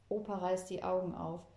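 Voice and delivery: ordinary delivery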